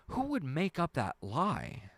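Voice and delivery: high, drawn-out disbelief; indignant, stretched-out question; incredulous. Real, not acted. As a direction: ask it slowly, high-pitched, truly baffled. Indignant on behalf of disbelieved viewer.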